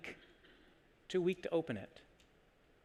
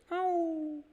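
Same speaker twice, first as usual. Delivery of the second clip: high, held little howl; little dog's yelp; 14 semitones higher than usual. Retold or imitated. Preacher plays the little dog pawing the door, high howl. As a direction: imitate a small dog's high, drawn-out cry. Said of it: "just goes like this".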